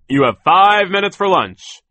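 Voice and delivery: high, slow, drawn-out bellow; shouted order. A narrator's reading. Foreman hands out lunch to workers, shouting. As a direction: holler it at the whole crew, stretching FIVE. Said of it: "he shouted".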